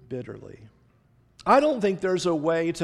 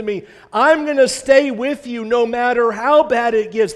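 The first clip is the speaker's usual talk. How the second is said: loud, raised, emphatic protest; 4 semitones higher, 7 dB louder than usual. Acted. Peter promising Jesus he'll never deny him, loud and insistent. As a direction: swear it with full-voiced conviction. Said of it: "Peter's response to that is".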